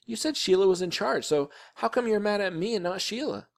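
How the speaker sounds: high, indignant argument; protesting boy. A narrator's reading. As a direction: argue back like a wronged kid. Boy argues back to mother.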